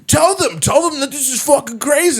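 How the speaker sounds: very high-pitched mock outrage; worked-up appeal. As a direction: imitate an agitated man demanding backup. Retold upset man appealing to onlooker.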